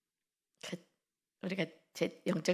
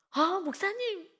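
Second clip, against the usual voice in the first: high, crying plea, voice pitched way up; pleading young woman; 11 semitones higher, 4 dB louder, slower than usual. Retold or imitated. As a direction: wail it like a distressed girl begging. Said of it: "막 이래서". (높고 울먹이는 젊은 자매 목소리)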